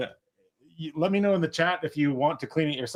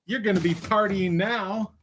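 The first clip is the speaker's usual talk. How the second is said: showy reveal; 7 semitones higher, slower than usual. Real, not acted. Revealing the prize.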